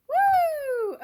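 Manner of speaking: very high, loud joyful woo; excited whoop. Real, not acted. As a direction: whoop out the energy. Whoop of release in reading.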